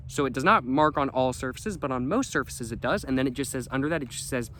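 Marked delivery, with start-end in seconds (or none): none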